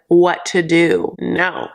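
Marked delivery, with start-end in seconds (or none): none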